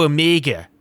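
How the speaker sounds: New Zealand vowels in Omega; Kiwi accent. Real, not acted. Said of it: "my Kiwi accent is showing".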